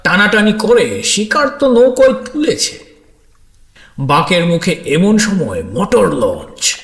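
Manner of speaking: usual speaking voice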